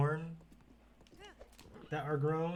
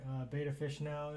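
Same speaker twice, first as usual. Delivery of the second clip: normal talking voice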